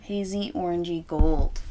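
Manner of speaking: ordinary delivery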